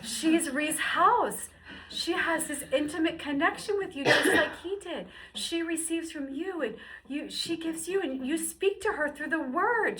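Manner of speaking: thrilled high exclamation; excited realization. Retold or imitated. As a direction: burst out with the discovery.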